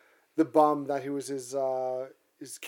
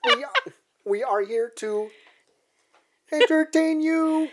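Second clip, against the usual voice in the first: silly high voice; 13 semitones higher, 5 dB louder than usual. Acted. Goofy intro line.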